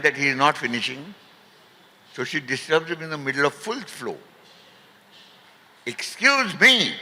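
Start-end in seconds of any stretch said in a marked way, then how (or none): 5.8-6.9 high, drawn-out "excuse me"; slow, high-pitched mock outrage; indignant interruption. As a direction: imitate a righteous woman butting in. Righteous woman interrupts a stranger.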